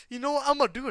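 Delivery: loud brush-off. Real, not acted. Loud reply to chat suggestion.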